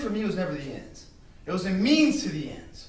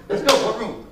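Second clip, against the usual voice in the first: mock fight challenge; 3 semitones higher, 8 dB louder than usual.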